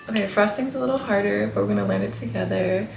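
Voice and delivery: ordinary talk, nothing marked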